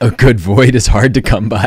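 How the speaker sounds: said through laughter, loud; laughing. Real, not acted. Streamer laughing as he invents a book title. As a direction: laugh while joking out a title.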